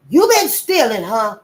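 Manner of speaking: sharp, loud, high accusing shout; angry accusation. Acted. Mistress confronting the boy she caught stealing, angrily. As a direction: snap the accusation at him.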